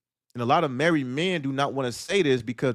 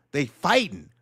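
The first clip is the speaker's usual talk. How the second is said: ordinary delivery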